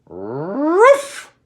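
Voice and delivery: loud high bark; big dog bark. Acted. Chihuahua barking to scare the dinosaur, loud bark. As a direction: bark it out like a brave little dog. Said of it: "Scapito let out a big Jurassico bark".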